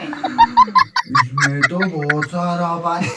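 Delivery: slow, dazed, laughing; stoner. Real, not acted. Husband high on bhang.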